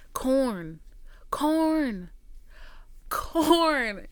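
stunned at the endless cornfield, exclaiming; incredulous exclaiming. Real, not acted. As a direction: call out each Corn in disbelief.

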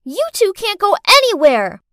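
put-on loud, high little girl voice; bossy child shouting. A narrator's reading. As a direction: order the grown-ups around. Child shouts, blocking the adults.

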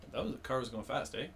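quick remark, then questioning "eh"; Canadian "eh" tag. Shown as a demonstration. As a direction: tack on "eh" for a reaction. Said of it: "Whereas A, I'll be like".

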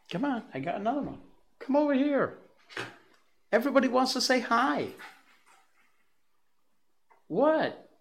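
high, eager coaxing; dog talk. Real, not acted. Host urges the dog to say hi, high-pitched dog talk.

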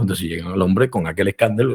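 usual speaking voice

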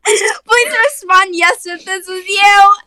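laughing while reading. Retold or imitated. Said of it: "They're like".

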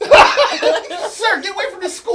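high, laughing telling-off; mock warning through laughter; laughing mock order. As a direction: play a scandalized bystander, cracking up. Plays offended bystander while laughing.

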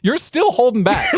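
ordinary talk, nothing marked

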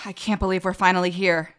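dreamy sigh. Acted. Annie sighs, dreamy.

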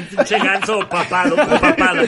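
laughing through the words; cracking up mid-sentence. Real, not acted. Hosts mocking the logo. (riéndose)

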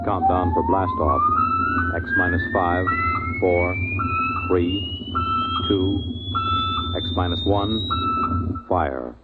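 measured countdown over a radio link; launch countdown. Acted. Mission control counting down to blast off, measured radio countdown.

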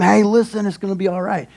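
soft reassurance, dropping away at the end; calm the frightened crowd; softly acted comforting voice; speaking faith. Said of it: "he speaks faith over them".

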